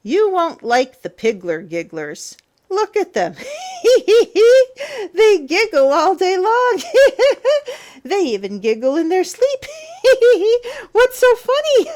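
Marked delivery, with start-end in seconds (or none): 2.7-11.9 high loud giggling through the words; squealing laughter, high and loud; giggling monsters. Acted. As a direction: giggle away like silly piggy monsters. Piggler Gigglers giggle nonstop.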